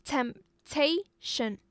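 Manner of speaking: temptation in three separate slow beats; syllable by syllable. Shown as a demonstration. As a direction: temptation split into temp, ta, tion. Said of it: "It's a three-syllable noun".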